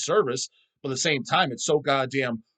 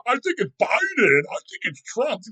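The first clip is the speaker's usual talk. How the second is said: much higher, whiny mock of the clueless; mock naive voice; 9 semitones higher, 4 dB louder than usual. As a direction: sneer at people who don't get it. Mocking naive people's opinions.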